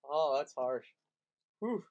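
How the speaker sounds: ordinary delivery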